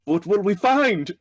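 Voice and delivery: high, loud, stretched-out call; yelled question. Acted. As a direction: holler it insistently across the distance. Repeats yelled question to centaur.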